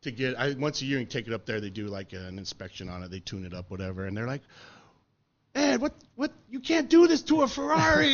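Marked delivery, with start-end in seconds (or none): 5.6-8.1 much higher, louder squeal of disbelief; mock mechanic shrieking at the ruined Ferrari; outraged Italian mechanic. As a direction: play the horrified Ferrari mechanic. Mechanics scolding him at inspection.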